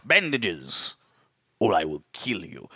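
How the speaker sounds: low, soft menacing threat; hushed sinister ultimatum; low villain threat. Retold or imitated. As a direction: threaten him quietly and darkly.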